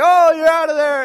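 put-on loud, high umpire bellow calling the kid out; hammed-up umpire yelling you're out; umpire's call. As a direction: holler the strikeout call like an umpire. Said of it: "And he's like".

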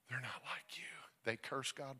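soft, whispered confession; hushed whisper. Retold or imitated. Nurse speaking to the grieving father, hushed whisper.

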